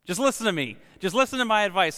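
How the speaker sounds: mock shouted directions. Spouse giving directions from the other room, half-hearted mock shout. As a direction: imitate someone calling out instructions from another room. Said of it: "shouting the directions to you".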